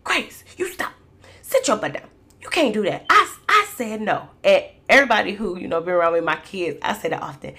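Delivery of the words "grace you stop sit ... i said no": put-on high, bossy little-girl voice copying mom; a small child parroting her mother's scolding; imitating four-year-old. Mother imitates her four-year-old scolding the baby, high and bossy. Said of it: "literally is saying what I'm saying".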